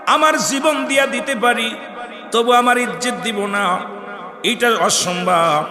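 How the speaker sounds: high, loud chant easing down at the end; full-force preaching. Acted. Preacher calling his brothers to hear the saint's refusal, full-force preaching. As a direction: proclaim it in long stretched cries.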